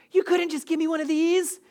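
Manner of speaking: a hint of comic indignation; mock frustrated. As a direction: play the hurt driver, lightly huffy. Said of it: "I get flustered and frustrated 'cause I'm like".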